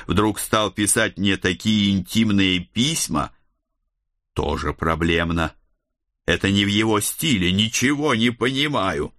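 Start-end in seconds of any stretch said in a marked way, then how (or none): none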